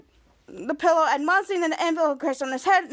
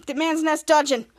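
normal talking voice

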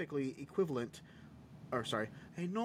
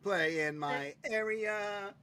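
slow, high, creepy mascot voice; 6 semitones higher, much slower than usual. Acted. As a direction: lure the kids in slowly with a sickly sweet tone. Creepy mascot luring children.

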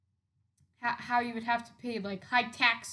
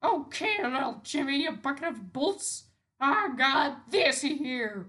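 higher, louder, taunting the robot; mock bossy voice; 4 semitones higher, 7 dB louder than usual. Kid taunting his robot, in a higher louder voice. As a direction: lord it over little Jimmy.

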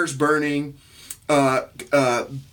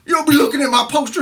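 put-on protective teen cousin; 12 semitones higher, 5 dB louder, faster than usual. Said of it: "And he's like".

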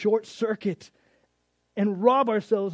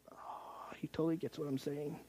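unvoiced whisper, much softer; whispered aside; 2 semitones lower, 14 dB quieter than usual. Peter whispers aside about Jesus, hushed. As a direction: whisper it to yourself, relieved.

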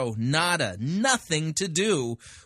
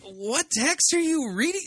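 incredulous; 13 semitones higher than usual. Real, not acted. Incredulous at the preacher's reading.

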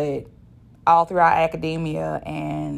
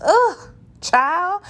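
high, louder drawn-out ugh of disgust; loud, high, fed-up sigh-word; exasperated groan; 6 dB louder than usual. Real, not acted. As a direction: let the exasperation spill out. Exasperated reaction to managers.

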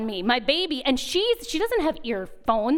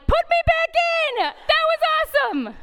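much higher, louder gleeful plea; 4 dB louder than usual. Retold or imitated. Molly begging the technicians to put her back in, excited. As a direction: beg to go back in, thrilled.

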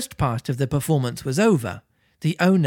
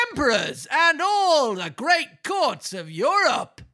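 pompous ringmaster; 13 semitones higher than usual. Acted. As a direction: puff yourself up like a circus ringmaster. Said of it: "in a loud, pompous voice".

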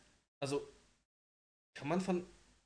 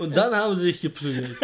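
laughing; 6 semitones higher, 14 dB louder than usual. Real, not acted. Laughing at own joke.

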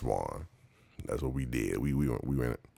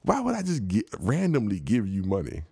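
incredulous; 8 semitones higher than usual. Retold or imitated. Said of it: "And I'm like".

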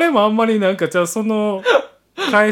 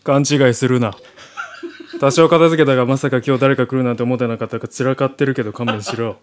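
lower, curt soldier voice; gruff soldier; 5 semitones lower than usual. Acted. Soldier welcoming the heroine home, gruff and curt. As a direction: play a tough guy hiding embarrassment. Soldier character in audio drama.

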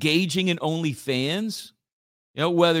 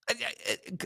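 normal talking voice

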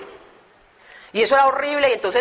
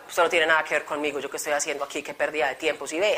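softer, lower muttering; grumbling under breath; 6 semitones lower, 6 dB quieter, faster than usual. Retold or imitated. Grumbling to herself during the testimony, muttered softer. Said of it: "Yo renegaba y renegaba".

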